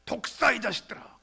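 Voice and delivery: loud, high, fierce cry at the crowd; John the Baptist crying out at the people; prophet's shout. Retold or imitated. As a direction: roar it like a prophet in the wilderness. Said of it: "이렇게 외치죠".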